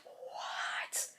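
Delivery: breathless whispered what; stunned whisper. Retold or imitated. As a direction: gasp it out under your breath. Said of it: "and she's like".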